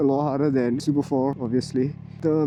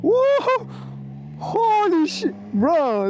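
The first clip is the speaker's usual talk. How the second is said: surprised whoa; 13 semitones higher, much slower than usual. Real, not acted. First ride, startled by power.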